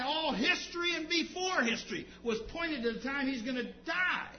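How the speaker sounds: loud, declaiming pulpit voice; forceful sermon proclamation; impassioned preaching. Real, not acted. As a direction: preach it at full force, punching key words.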